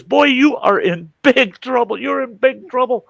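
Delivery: high, loud imitation of a scolding mother; scolding mom. Mom telling her son off, scolding. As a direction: lay down the law in mock-mom falsetto.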